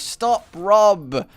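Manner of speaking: garbled sleepy warning. Acted. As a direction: blurt out half-formed words from sleep. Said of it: "it kinda comes out as".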